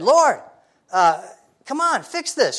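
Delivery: high, pleading complaint to the Lord; whiny Martha. Retold or imitated. Martha whining to Jesus, high and pleading. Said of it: "she's having a pity party for herself".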